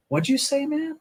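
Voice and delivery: a startled voice that jumps high; stunned disbelief. Retold or imitated. Stunned reply to a friend's claim.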